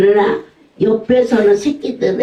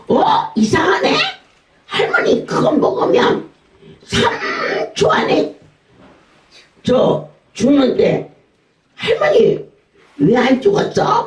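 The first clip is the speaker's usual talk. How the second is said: put-on much higher, playful puzzled boy; teasing grandson; 11 semitones higher than usual. Grandson teasing grandma who didn't die, high and playful. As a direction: feign surprise like a mischievous kid.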